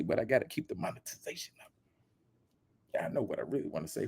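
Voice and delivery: fast conspiratorial whisper; whispered aside. Real, not acted. Whispering an aside to viewers about monetization. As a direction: hush it so the platform won't hear. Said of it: "Y'all know what I wanna say".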